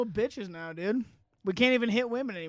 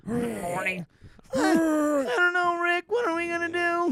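put-on high, slow, whiny panicky teen voice; nervous, cracking Morty whine; Morty impression; 9 semitones higher, slower than usual. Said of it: "That's a pretty good Morty".

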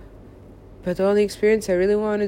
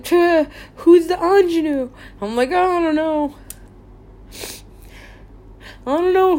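mock exasperated inner voice; 7 semitones higher, 5 dB louder than usual. Retold inner dialogue, raised.

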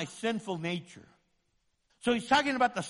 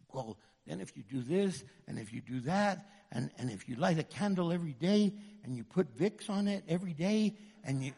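soft, hurried, dismissive listing; mocking tempter; 4 semitones lower, 6 dB quieter than usual. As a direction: rattle it off quietly. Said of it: "weird stuff".

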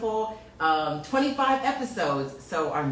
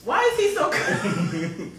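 slower, laughing through the words; 8 semitones higher, 4 dB louder, slower than usual. Real, not acted. Host repeats her question about the calm guest, laughing. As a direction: chuckle while you ask it.

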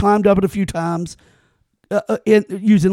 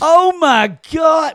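loud, high, slow Oh, my God; shocked; 7 semitones higher, 4 dB louder, much slower than usual. Real, not acted. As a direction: cry out in real disbelief. Shocked at the no-nails detail.

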